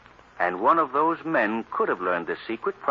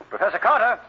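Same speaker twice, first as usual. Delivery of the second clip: high, loud urgent call; 10 semitones higher, 5 dB louder than usual. Acted. Cranston calling for the missing Carter, loud. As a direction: shout his name into the dark apartment.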